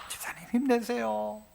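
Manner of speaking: softly cheering up a deacon; soft, kind, reassuring words; gentle encouragement. Retold or imitated. 성도가 힘든 집사님을 부드럽게 격려함. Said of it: "가서 격려해줘라".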